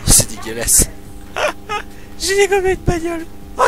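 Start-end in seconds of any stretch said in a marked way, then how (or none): 1.3-3.7 high-pitched laughing while talking; laughing hard. Real, not acted. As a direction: crack up mid-sentence. Laughs hard crashing car.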